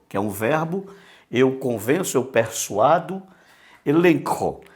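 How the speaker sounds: ordinary delivery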